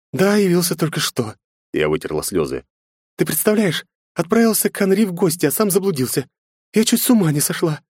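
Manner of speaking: high upset mother; tearful complaint. A narrator's reading. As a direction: vent to husband, still shaken. (расстроенная жена)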